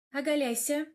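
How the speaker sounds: commanding. A narrator's reading. Velora orders her patient to strip, commanding. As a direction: give a short command. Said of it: "скомандовало моё чудо".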